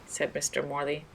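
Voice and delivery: usual speaking voice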